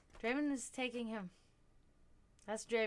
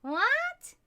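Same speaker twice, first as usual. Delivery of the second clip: even higher, loud startled rise; surprised what; 14 semitones higher, 8 dB louder than usual. Real, not acted. To the cat again, high loud surprised question. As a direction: squeak it up in disbelief.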